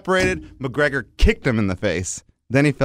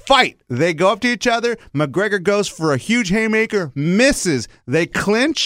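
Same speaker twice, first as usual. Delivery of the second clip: higher-pitched, excited blow-by-blow; hyped fight recap; 6 semitones higher, slower than usual. Retold or imitated. Recounting a fight to his coach, hyped. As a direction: call the fight like an amped commentator. Mimics fight commentary, retelling bout.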